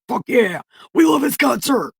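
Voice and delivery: high, loud fan shout; cheering crowd. Acted. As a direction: yell it like a hyped crowd at a show.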